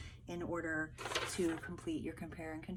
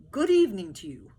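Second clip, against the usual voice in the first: louder, friendly greeting; polite soldiers; 3 semitones higher, 13 dB louder than usual. Acted.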